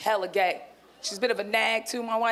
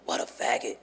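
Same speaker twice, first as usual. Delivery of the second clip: much lower, unvoiced scornful aside; low muttered thought; 12 semitones lower, 3 dB quieter than usual. Comic voicing her inner thought, a low, breathless scoff. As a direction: drop the voice low and breathy in contempt. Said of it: "my first thought was like".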